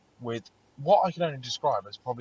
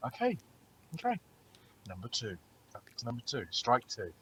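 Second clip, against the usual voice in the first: much softer resigned count; tally it under your breath; muttered aside; 11 dB quieter than usual. Retold or imitated. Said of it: "it was just like".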